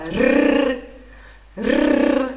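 two long loud tongue trills; rolled R. Shown as a demonstration. Teacher asking students to copy, loud rolled R. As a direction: trill the tongue high and loud, then repeat. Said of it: "Faça aí você agora".

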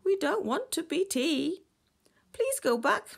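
high, loud plea; loud, high-pitched protest; crying out. A narrator's reading. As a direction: cry it out as a pleading fish. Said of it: "cried the cod".

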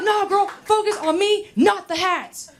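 high, whiny plea; guy begging her to focus; pleading guy. Retold or imitated. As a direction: beg her in a high comic whine. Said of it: "He was like".

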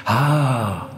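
long held "aaah" of an army; chanted "ah". A narrator's reading. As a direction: chant one drawn-out, falling note. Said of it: "chanta une autre armée". (long « ah » chanté)

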